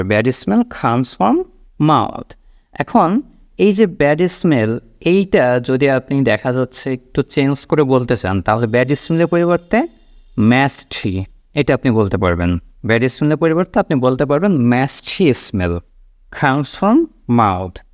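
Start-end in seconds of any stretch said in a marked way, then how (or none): 0.0-2.3 very slow, every word spelled out; slow model sentence. Shown as a demonstration. Teacher modelling a sentence for the class, very slow. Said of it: "এইভাবে বিষয়টা আসবে". 16.4-17.8 very slow, every word spelled out; slow model sentence. Shown as a demonstration. Teacher modelling a sentence for the class, very slow. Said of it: "এইটাও কিন্তু আপনি চাইলে বলতে পারেন".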